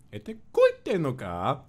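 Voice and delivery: high, slow line with a rising "koite"; imitating Kotoka's little koi. Recalling the line that peaked the mic, high and slow. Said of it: "the little koi".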